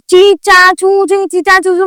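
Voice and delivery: high chirpy chatter imitating a noisy room; zoo-like chatter. Mocking the noisy crowd as birdlike chatter. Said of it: "चिड़िया घर बना हुआ था".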